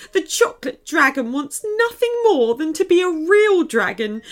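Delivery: put-on high, tearful elf girl telling her sad tale; a small frightened elf pouring out her story; upset little elf girl. A narrator's reading.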